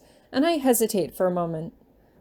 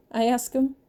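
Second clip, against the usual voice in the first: plain reading voice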